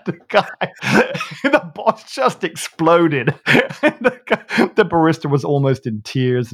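high, loud laughing speech; cracking up while finishing the story. Real, not acted. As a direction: talk through real laughter at the punchline. Laughing at own story's punchline.

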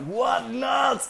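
high yelled question; shouted question. Retold or imitated. Asking Aito which knot over the storm, high shout. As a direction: yell it over the wind.

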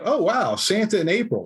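high, delighted oh wow; mock delight. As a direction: play the happy surprise. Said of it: "it's like".

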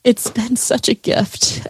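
real emotion welling up as she speaks; getting emotional. Real, not acted. Guest thanking the host, choked up. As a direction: voice catching, slow and soft. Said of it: "now I'm gonna get emotional".

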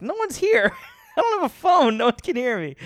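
giddy self-quote. Retold or imitated. Alone in his car, gleefully realizing nobody can hear. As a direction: burst out with giddy relief at being alone. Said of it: "I was like".

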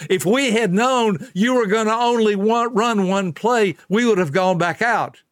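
high, pleading whine of the players; mock the quitters begging to come back; hammed-up whine of players to coach; whiny quitters. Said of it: "here's what they said".

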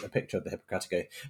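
ordinary talk

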